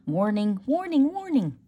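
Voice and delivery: mock warning. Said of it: "close your ears if you don't like".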